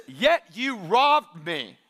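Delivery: slow, grave accusation as God; God accusing. Acted. God confronting his people, slow and grave. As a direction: let God's charge land slowly. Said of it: "And God says".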